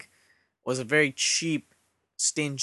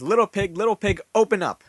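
louder, higher storybook wolf demand; wolf calling out; 7 semitones higher, 7 dB louder than usual. A narrator's reading. Hungry wolf at the stick house door, calling out. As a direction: call it out at a closed door.

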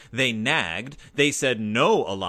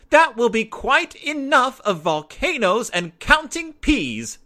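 higher, louder scolding shout; shouting mother; 11 semitones higher, 7 dB louder than usual. A narrator's reading. Mama shouting at cubs playing with food, loud scold. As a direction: stop the cubs' nonsense with a shout. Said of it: "shouted Mama".

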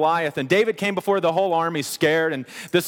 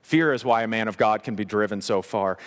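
ordinary talk, nothing marked

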